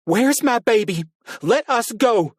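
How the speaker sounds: high, loud frantic screaming; high loud desperate shout at the captor; exploding shout. A narrator's reading. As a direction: scream it at the hooded figure, desperate. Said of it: "Carrie seethed and exploded".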